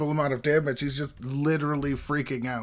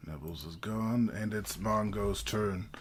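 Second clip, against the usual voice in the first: usual speaking voice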